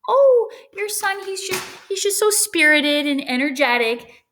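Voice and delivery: sweeten bad news for a parent; gushing teacher. Teacher soothes parents at conferences, gushing high voice. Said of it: "talking to parents at conferences".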